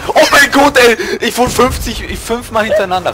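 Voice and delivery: loud raging outburst; raging gamer. Real, not acted. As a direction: rage at the unfair game. Raging at hacker enemy. (wütender Gamer-Ausbruch)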